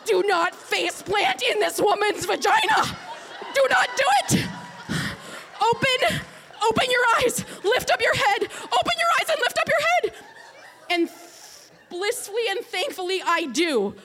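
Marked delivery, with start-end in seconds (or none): 0.1-10.1 loud, much higher, half-laughing pleading orders; rising panic, yelling at yourself to wake up; frantic inner yelling. Retold or imitated. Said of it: "I start yelling in my head".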